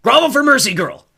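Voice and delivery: war god's domineering roar; full-volume, menacing command; angry bellow. A narrator's reading. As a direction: bellow the order high and loud. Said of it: "Ares bellowed".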